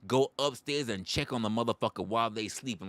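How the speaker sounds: usual speaking voice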